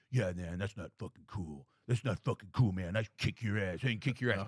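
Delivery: a hint of a drunk brawler; tough young guy. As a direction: growl it out like a bar tough guy. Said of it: "And he's just like".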